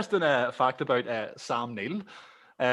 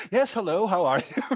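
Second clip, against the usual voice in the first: higher, mock-polite phone greeting; imitating a courteous answer with a laugh underneath; sudden polite phone voice; 5 semitones higher than usual. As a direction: switch from mock rage to civil hello. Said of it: "It's like the reverse of that".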